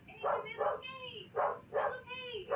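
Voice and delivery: slow, high-pitched cooing; high, drawn-out comforting voice; soothing a pet. Real, not acted. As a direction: reassure a worried pet, drawing it out. Soothing a pet away from mic.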